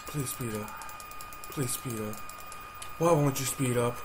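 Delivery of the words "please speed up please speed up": quiet pleading. Real, not acted. Begging the slow game to hurry.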